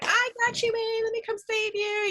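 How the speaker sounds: high, sing-song hero voice; playful swoop-in rescue; mock rescuer. Owner coming to save her dog from the goat, playful high voice. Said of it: "And I'm like".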